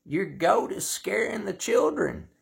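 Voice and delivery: high, loud cop yell across the yard. Retold or imitated. Cop yells at Bowhead about his goat, high mock yell. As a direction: shout it like a cop jumping from his car. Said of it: "and yells out".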